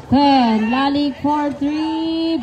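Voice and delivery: loud, hyped call of the three; excited announcer call. Real, not acted. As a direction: pump up the crowd on the made three. Announcer calls a three-pointer. (malakas at masiglang pagtawag)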